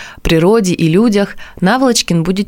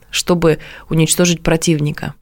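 ordinary talk, nothing marked

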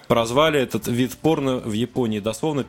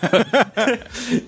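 high giggling burst; high laughter; 9 semitones higher than usual. Real, not acted. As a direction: break into giggles. Laughing at article.

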